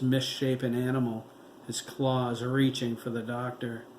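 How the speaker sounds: usual speaking voice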